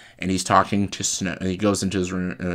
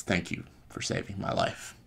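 softly voiced gratitude; quiet thanks; 8 dB quieter than usual. Han quietly thanking Finn for saving his life, quiet.

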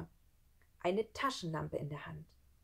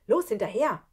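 loud, higher rallying cry; urgent, raised-voice command; urgent call; 4 semitones higher, 10 dB louder than usual. Acted. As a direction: spur a friend into the chase. Boy urges friend to give chase.

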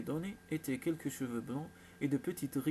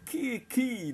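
high-pitched, raised innocent question; feigned innocence; 8 semitones higher, 6 dB louder, slower than usual. A narrator's reading. Sister dodging her brother's question, feigning innocence. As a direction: play dumb, voice lifted high. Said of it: "en feignant l'innocence".

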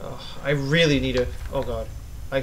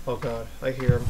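ordinary talk

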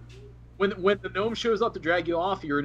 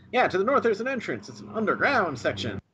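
raised-pitch game character reporting in; high-pitched character voice; 5 semitones higher than usual. Acted. Game master answers as the character over the earpiece, high-pitched voice. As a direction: put on a high voice, easing down toward the end. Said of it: "such a high-pitched voice".

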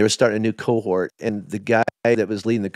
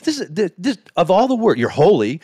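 high, indignant argument with God; retold outburst, pitched up and pushing; retold protest; 8 semitones higher than usual. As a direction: protest in disbelief, voice climbing high. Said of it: "And I cried".

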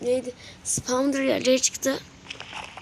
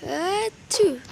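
frustrated yelp; 7 semitones higher, 5 dB louder, slower than usual. Real, not acted. Game mishap exclamation.

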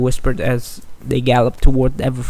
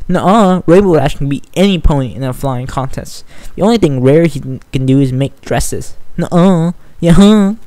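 bickering; 3 semitones higher, 5 dB louder than usual. Acted. Two fillies bickering over their heroes, stubborn.

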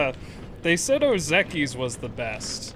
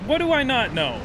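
higher, more insistent demand; indignant repeated question; 7 semitones higher, 3 dB louder than usual. Real, not acted. Streamer challenging chat's doubt, indignant demand. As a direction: demand an answer, pitch raised.

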